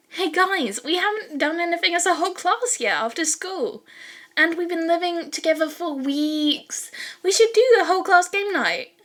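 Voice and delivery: high, bright-eyed pitch to the class, rising to a loud finish; eager Ashido voice. Acted. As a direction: sell the idea like a scheming cheerleader. Ashido pitching game night.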